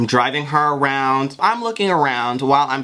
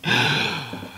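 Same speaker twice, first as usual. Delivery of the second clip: shocked gasp; 4 dB quieter than usual. Retold or imitated. Discovering the car was impounded.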